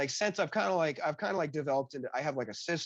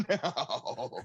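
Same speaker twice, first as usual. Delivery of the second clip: laughing no; 3 dB quieter than usual. Retold or imitated. Admits never done podcast.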